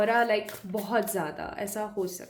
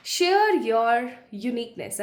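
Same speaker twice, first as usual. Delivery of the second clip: slow, louder card announcement; card title read; 5 dB louder, much slower than usual. Acted. Reader revealing the card to pile one, slow grand announcement.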